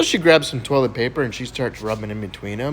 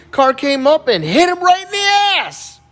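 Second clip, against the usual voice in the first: put-on loud slow kid voice; 14 semitones higher, 7 dB louder, slower than usual. Kid explains dog accident.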